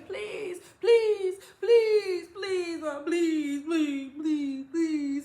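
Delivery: high, insistent pleading please; begging. Retold or imitated. Daughter begging mom again to walk home, high and whiny. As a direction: whine for it like a kid. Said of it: "I'm back to the begging".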